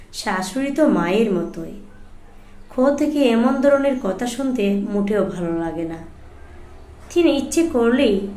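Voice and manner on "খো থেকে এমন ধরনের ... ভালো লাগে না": usual speaking voice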